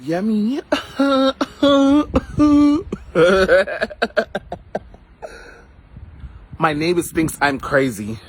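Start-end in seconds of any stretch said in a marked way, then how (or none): 0.7-4.8 loud high cackle that almost cries; wild cackling laugh. Real, not acted. Cackling wildly to her live viewers about being crazy. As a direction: burst into an unhinged cackle.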